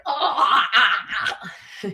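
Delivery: short laughing yells of release; mock release yells. Shown as a demonstration. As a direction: let out a few half-laughing ahs. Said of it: "vigorous movement".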